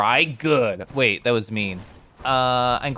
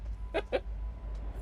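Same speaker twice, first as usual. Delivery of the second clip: breathy laugh; 15 dB quieter than usual. Real, not acted. Whispered laugh.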